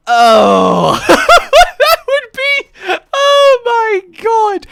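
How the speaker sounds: laughing hard. Real, not acted. Laughing at own joke.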